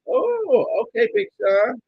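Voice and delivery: squeaky high mocking "oh okay"; high falsetto sarcastic tease. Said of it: "looking like".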